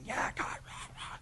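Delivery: hushed, voiceless imitation of distant yelling; mock screaming. Man cursing David from across the valley, muted mock scream. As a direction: mime a rant under your breath, no real volume. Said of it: "he's just screaming and yelling".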